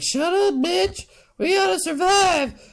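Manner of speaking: high-pitched bossy mom voice; mock a mother snapping at her kid; scolding mother. Paul's mom scolds him.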